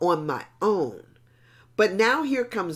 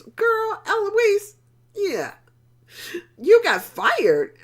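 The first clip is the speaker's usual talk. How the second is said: surprised; 13 semitones higher, 4 dB louder, slower than usual. Retold or imitated. Hearing friend's news on phone.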